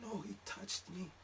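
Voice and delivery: put-on high, soft and slow girly voice; timid girlfriend. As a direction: girlfriend correcting him softly.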